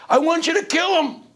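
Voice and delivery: high, strained demand for a killing; high pleading demand. Retold or imitated. Undertaker demanding the Godfather kill the men, high plea. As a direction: beg him to kill, voice cracking high. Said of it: "undertaker demanding revenge".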